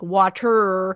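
"water" drawn out slowly with a heavy final R; country-western R. Retold or imitated. Choir teacher imitating kids singing, hard-R "water". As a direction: stretch "water" onto a long twangy R. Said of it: "if they sing R's".

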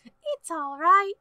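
put-on high, slow, feeble sick-child voice; Tiny Tim. Sick child reassuring Papa, high and slow invalid voice. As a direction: pitch it up like an ailing little one, drawn out. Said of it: "You said Tiny Tim".

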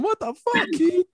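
put-on high, half-shouted drunk yell at a friend; calling out to his buddy from on top of a table, high mock yell; retold yell. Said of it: "like, yelling at him".

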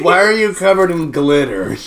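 loud demanding guard. Acted.

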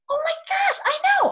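shrieking in disbelief; excited. Real, not acted. Recalling the aura reading to her friend, excited squeal.

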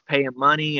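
normal talking voice

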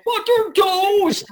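very high, loud, slow cartoon yell; the zany Ed letting it fly. Actor recalls playing Ed, very high and loud.

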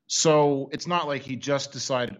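ordinary talk, nothing marked

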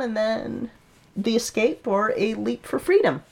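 normal talking voice

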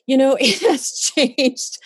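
slow, high, laughing disbelief; delighted slow laugh; laughing amazement. Real, not acted. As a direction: laugh it out in wonder. Amazed at her change.

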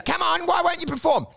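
high, pleading mimicry; pestering mock voice. Partner begging the comedian to perform, high mocking voice. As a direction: imitate someone nagging for a show.

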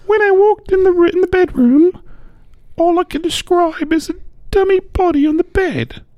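high, thin lady's voice; high woman voice. Retold or imitated. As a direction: squeak it out like Karen. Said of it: "I'm gonna try that again".

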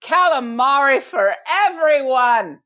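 slow, high, proud proclamation; grand announcement. Retold or imitated. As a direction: announce it to the whole room. Announcing to the bar.

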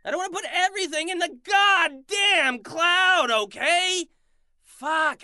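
loud, high furious yelling at the assistant; angry shout. Acted. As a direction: blow up at him, full volume.

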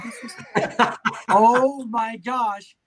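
laughing out oh my gosh, slowly; amazed and cracking up; laughing disbelief. Retold or imitated. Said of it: "look at it and go".

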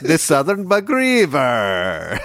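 put-on slow hammy Ukrainian accent. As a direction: drag out the river name. Joking Ukrainian voices.

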